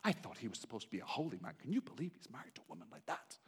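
snide gossip behind his back; gossiping onlookers. Acted. Townspeople judge Hosea behind his back, soft and quick.

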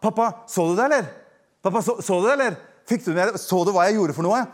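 a hint of a proud little boy fishing for praise; eager child asking. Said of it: "hva var det vi sa da?". (lett barnestemme, ivrig spørrende)

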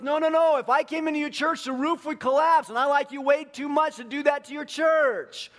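loud, high comic protest; exaggerated high-pitched refusal; emphatic protest. Friend protests invitation to church.

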